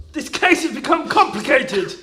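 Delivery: normal talking voice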